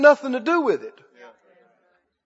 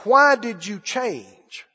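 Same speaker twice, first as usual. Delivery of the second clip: "Why did you change?" drawn out very slowly; slow emphatic; 3 semitones lower, much slower than usual. Shown as a demonstration. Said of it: "You could say it like this".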